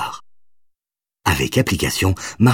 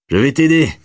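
helpful offer; 9 semitones higher, 4 dB louder than usual. A narrator's reading. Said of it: "proposa son beau-frère".

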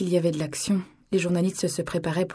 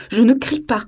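higher, louder denial; raised voice; 5 semitones higher, 9 dB louder than usual. Acted. Father raising his voice at his daughter. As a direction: insist you aren't shouting while shouting. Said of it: "sa voix était montée d'un ton".

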